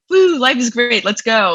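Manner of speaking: high-pitched, hammed-up cheerleader whoop; mock positivity. Guest mimicking the positive person she says she isn't, mock-peppy. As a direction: put on an over-the-top upbeat optimist. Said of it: "I'm not a positive, like, I'm not like".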